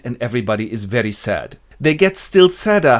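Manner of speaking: ordinary delivery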